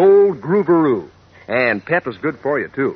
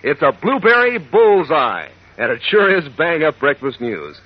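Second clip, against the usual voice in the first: put-on peppy old-time cereal pitch; ad read; 4 semitones higher than usual. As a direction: sell it to the kids with pep.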